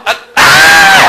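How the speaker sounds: very high, loud shriek; high, loud hammed-up scream; mock scream. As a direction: shriek as if poked. Third poke on the train.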